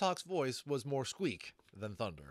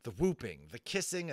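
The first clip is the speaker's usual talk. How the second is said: usual speaking voice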